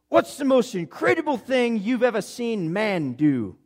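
high, slow, grand question in a put-on accent; [name]. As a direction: ask it with wonder in an exaggerated accent. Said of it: "got this incredible accent".

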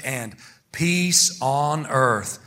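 very slow, word by word; leading group recitation. Shown as a demonstration. Preacher leading church in three words, very slowly. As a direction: stretch each word so the church can join. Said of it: "say these three words with me".

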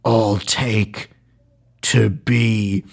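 lightly voiced action hero one-liner, broken by a pause; hint of an action hero impression; Jason Statham. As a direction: deliver the action hero's comeback in two weighted halves. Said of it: "Jason Statham goes".

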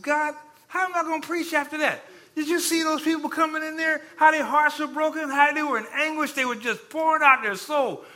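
high, strained retold protest; acted-out desperate questioning of God; anguished plea to God. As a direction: plead upward in dismay, pitch held high.

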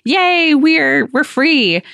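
sudden high relieved cheer; happy cheer. Retold or imitated. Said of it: "And it was like".

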